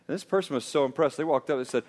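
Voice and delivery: ordinary delivery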